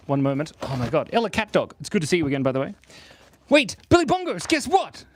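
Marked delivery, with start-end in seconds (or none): 3.5-5.0 high, loud shout to get attention; excited calling out. Pretend. Streamer hailing a viewer in chat, excited calling out. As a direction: call out urgently, pitched way up.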